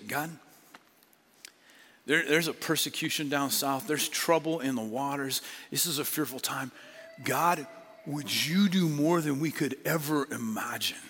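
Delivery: prayer. Acted. Leads the church in prayer.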